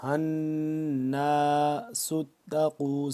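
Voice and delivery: الناس with the نون held in a nasal hum; nasal ghunna on the doubled noon. Shown as a demonstration. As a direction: hum through the نون of الناس, slow and chanted. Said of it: "نون مشدد پہ ہم نے یہاں پہ غنہ کرنا ہے".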